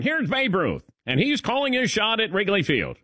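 high-pitched vintage play-by-play, hammed up; old-time radio broadcaster. Host imitating a radio call of Babe Ruth, old-time broadcaster voice. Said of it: "They sounded like that".

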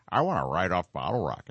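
low, put-on talking-cat voice; cat character voice. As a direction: play the cat making demands. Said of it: "She wants to light off".

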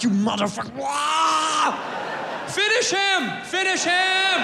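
building to high, mock battle cries; mock fight shouting. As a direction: yell like egging on a fight. Acted fight and game announcer.

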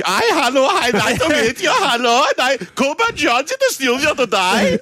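high, hammed-up Dutch accent. Suggesting Dutch as best accent, imitates a cheery Dutch greeting in accent. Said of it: "what about the Dutch?".

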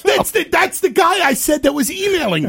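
high, loud, worked-up disbelief; incredulous shouting. Real, not acted. Host telling colleague who just called, incredulous shout. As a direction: burst out, astonished. Stunned after the call.

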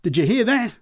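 high, loud incredulous call; excited repeat. A narrator's reading. Drunk calling out to the crowd behind him, excited. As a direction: turn to the crowd and demand it. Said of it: "kept repeating".

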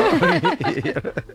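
high laugh at himself; self-mocking laugh. Real, not acted. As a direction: laugh at your own silly joke. Laughs at own silly joke. (risa aguda burlándose de sí mismo)

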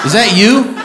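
usual speaking voice

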